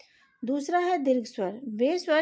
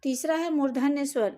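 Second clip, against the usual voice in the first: ordinary delivery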